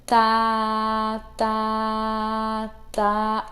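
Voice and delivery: "tá" sung flat and held; rhythm syllable. Shown as a demonstration. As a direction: sustain each "tá" on one steady pitch. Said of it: "a sílaba que eu falo, né, pra representar o som". ("tá" sustentado numa nota só)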